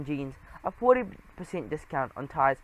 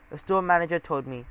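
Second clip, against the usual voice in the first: ordinary delivery, nothing marked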